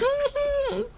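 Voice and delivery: high, soft teasing giggle. Acted. Co-worker teasing shy Rhea, soft high giggle. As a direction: giggle at her shyness.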